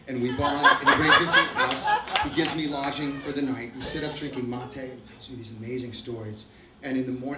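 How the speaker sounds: normal talking voice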